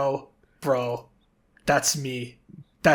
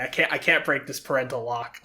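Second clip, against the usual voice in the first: ordinary talk, nothing marked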